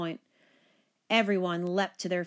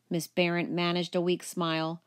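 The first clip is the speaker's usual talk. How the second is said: plain reading voice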